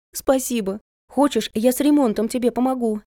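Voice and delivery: high, eager offer; grateful eagerness. A narrator's reading. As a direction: pitch lifted with relieved gratitude. Sister thanks her, offers help.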